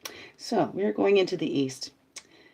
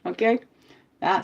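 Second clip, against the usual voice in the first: usual speaking voice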